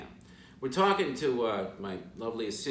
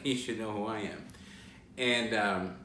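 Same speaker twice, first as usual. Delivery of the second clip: usual speaking voice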